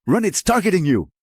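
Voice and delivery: urgent shouted warning; shouting. A narrator's reading. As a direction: yell it as a warning. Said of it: "the man shouted".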